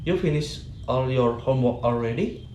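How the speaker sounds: "already" swoops up at the end; rising question intonation. Shown as a demonstration. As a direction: make it a question with a climb on "already". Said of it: "rising intonation".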